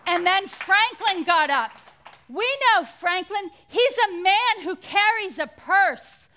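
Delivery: high, loud, ringing sermon delivery; full-force preaching. Real, not acted. Preacher praising Franklin's generosity to the congregation, impassioned and loud. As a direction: build it up like a rousing sermon.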